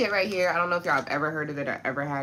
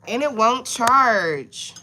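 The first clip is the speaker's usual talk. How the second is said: slow, loud, higher complaint; frustrated; 8 semitones higher, 11 dB louder, much slower than usual. Real, not acted. Complaining to viewers the phone won't charge, slow. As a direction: drag it out in exasperation. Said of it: "I'm really getting frustrated".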